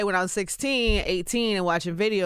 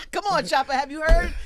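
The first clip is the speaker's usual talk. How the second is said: pleading through a laugh; 7 semitones higher than usual. Real, not acted. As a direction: coax him while laughing. Laughing coaxing.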